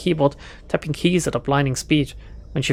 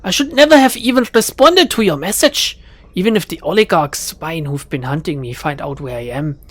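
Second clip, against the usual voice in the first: put-on German accent groan; 3 dB louder than usual. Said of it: "she groans".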